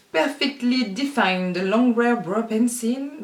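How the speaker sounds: slow, put-on English accent on the product name. To her viewers, reading a pencil's name in a mock English accent. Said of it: "Vous aimez mon accent anglais".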